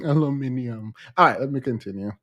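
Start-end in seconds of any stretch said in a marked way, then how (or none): 0.1-0.9 aluminium said again softly through a laugh; laughing repeat. Shown as a demonstration. Joking with Jamaican viewers, repeats aluminium laughing softly. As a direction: laugh while saying aluminium. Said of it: "Jamaicans always adding random syllables".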